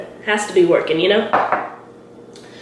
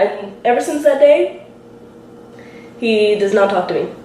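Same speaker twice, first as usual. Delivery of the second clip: usual speaking voice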